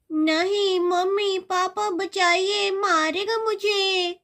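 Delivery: slow, drawn-out wailing plea; pleading for rescue. Acted. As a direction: cry out to parents for help. Girl calls parents for help. (घबराकर मदद की पुकार)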